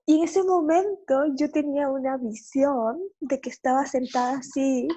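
ordinary talk

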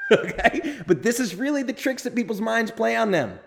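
amused, laughing as he talks. Real, not acted. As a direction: laugh it off while still making the point. Laughs at own point.

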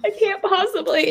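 high-pitched, squirming refusal; playfully overdone reluctance; mock reluctant protest. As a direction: balk as if asked the impossible. Acting out balking at coach's request.

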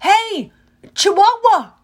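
high, loud taunting yell; loud high challenge. A narrator's reading. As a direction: shout across the deck to draw the beast. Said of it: "and yelled".

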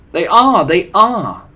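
high, very loud agreement; shouted high-pitched chorus; eager chorus. A narrator's reading. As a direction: chime in like excited witches. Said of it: "chorused the English witches".

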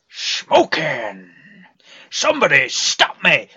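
manic cartoon shout; loud, wild Mask voice; Jim Carrey impression. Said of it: "all the Jim Carreys".

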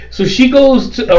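ordinary delivery

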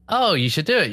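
usual speaking voice

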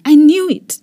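loud, high sudden outburst; exclaiming. A narrator's reading. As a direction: burst out in dismay. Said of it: "David exclaimed".

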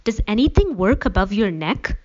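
a narrator's contained version of a scream; restrained scream. A narrator's reading. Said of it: "Allie screamed".

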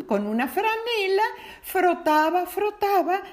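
high, rising anticipation; building suspense. A narrator's reading. Suspense builds rubbing lamp. (suspenso creciente)

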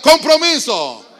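very loud, slow, high-pitched shout; strong call-out. Shown as a demonstration. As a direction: belt it out for the crowd to repeat. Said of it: "Vamos fuerte". (consigna gritada con fuerza)

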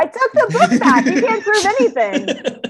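playfully denying the theft to the hosts with a laugh; mock defiance. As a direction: play the guilty suspect denying it. Jokingly denying book theft.

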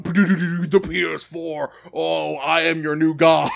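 grandiose overlord proclaiming godhood; lightly voiced arrogant overlord; booming arrogant alien overlord. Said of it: "going rapid fire, going".

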